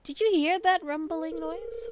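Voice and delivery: loud, high startled outburst. Real, not acted. Player startled by thunder in her game, loud and high. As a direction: jump at a sudden rumble of noise.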